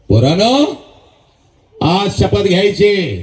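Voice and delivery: normal talking voice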